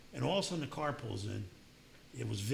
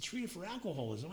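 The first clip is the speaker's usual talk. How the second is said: incredulous echo; 6 semitones higher than usual. Retold or imitated. Told he's in treatment.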